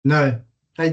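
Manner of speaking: usual speaking voice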